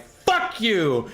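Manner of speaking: high, mock-tearful anger; worked-up outburst. Chemist to a dumbass in the supermarket, worked-up outburst. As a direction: rage as if about to cry. Imagined fight with supermarket stranger.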